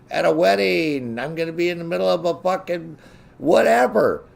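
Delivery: loud, high-pitched ranting list; exasperated mock announcement. Showing friends how to announce being unreachable, exasperated and loud.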